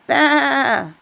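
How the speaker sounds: high, bleating baa from a wolf posing as a sheep. Acted. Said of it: "he bleated".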